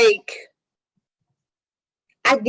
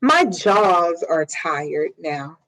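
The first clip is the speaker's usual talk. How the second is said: weary drag; 6 semitones lower, much slower than usual. Retold or imitated. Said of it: "once you say".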